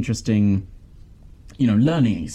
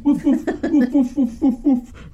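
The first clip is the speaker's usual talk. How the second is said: high yappy mock woofs; laughing, high-pitched pretend barks; imitated dog barking; 14 semitones higher than usual. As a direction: play the boy pretending to be a puppy. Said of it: "The boy pretends to be a dog".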